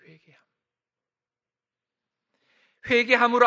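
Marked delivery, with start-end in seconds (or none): 0.0-0.4 whispered 회개함; preacher whispers 회개함 to the congregation. Real, not acted. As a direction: breathe the word 회개함 as a whisper. Hushed repetition of key word.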